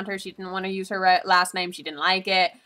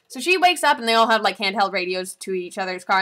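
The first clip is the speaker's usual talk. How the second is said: ordinary delivery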